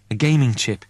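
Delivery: ordinary delivery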